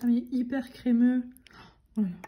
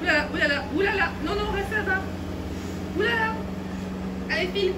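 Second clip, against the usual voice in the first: panique; 9 semitones higher, faster than usual. Real, not acted. Une abeille s'approche.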